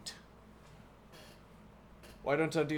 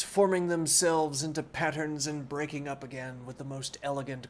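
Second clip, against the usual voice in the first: clear, steady reading-aloud voice; story narration; 5 dB louder than usual. Acted. Streamer reading Don Quixote aloud to chat, in narration voice. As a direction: read the book to chat like a storyteller.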